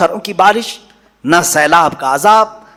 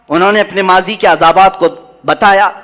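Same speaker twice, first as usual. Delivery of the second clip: ordinary delivery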